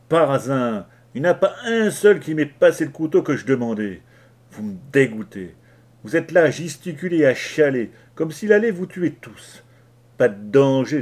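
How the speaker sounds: angry rant. Acted. Leach berates the frightened crew.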